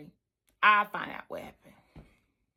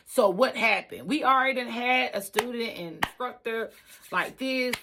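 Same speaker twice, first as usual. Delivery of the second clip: ordinary delivery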